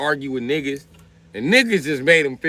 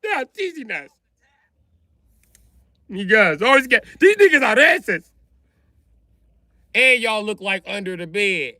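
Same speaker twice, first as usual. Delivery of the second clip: much higher, whiny plea to a rescuer; high pleading complaint; 10 semitones higher than usual. Retold or imitated. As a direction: beg for help up high, wounded. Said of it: "They was like".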